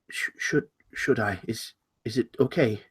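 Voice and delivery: soft, stammering, unsure; timid asking. Acted. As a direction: falter quietly as you seek permission. Host timidly asks rabbit's permission.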